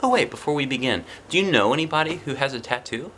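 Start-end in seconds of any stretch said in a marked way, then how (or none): none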